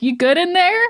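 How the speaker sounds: high, bright-voiced call into the circle; lightly voiced worried friend calling in; mock concerned check-in. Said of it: "being like".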